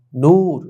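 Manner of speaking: নূর loud, with a long "oo"; long u vowel. Shown as a demonstration. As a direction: hold the "oo" in নূর. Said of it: "দীর্ঘকারের মতো উচ্চারণ". (নূর-এ ঊ জোরে টেনে বলা)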